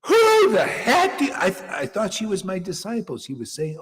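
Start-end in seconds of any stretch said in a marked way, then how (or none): 0.1-1.3 much higher, louder offended snap; mock indignant student. Guru imitating a disciple turning on him, high mock outrage. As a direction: bristle like an insulted disciple. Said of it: "And she said".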